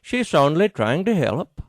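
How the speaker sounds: lightly voiced Scandinavian au pair; Scandinavian accent. Au pair pleading with the dog, light Scandinavian accent. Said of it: "she said in a Scandinavian accent".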